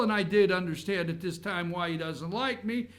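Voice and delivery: usual speaking voice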